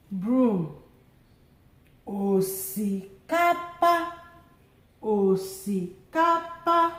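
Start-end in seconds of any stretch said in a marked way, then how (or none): 2.1-6.9 Osikapa said slowly, syllable by syllable; teacher repeating Osikapa; slow Igbo word. Shown as a demonstration. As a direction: sound out Osikapa for the class. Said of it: "let's do the tone marks together".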